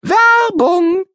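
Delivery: bright announcer call of Werbung; title announcement. Shown as a demonstration. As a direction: present Werbung as the big heading. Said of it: "Es geht weiter mit".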